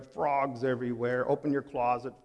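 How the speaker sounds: usual speaking voice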